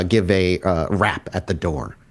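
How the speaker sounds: ordinary delivery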